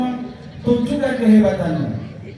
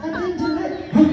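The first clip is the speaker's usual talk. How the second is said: monkey puppet voice; 8 semitones higher than usual. Acted. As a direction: tease like a naughty little monkey.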